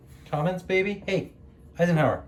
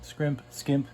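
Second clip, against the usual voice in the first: normal talking voice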